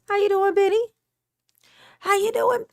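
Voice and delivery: high, bright hello; high excited greeting. Real, not acted. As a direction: greet them with delight. Greeting viewers.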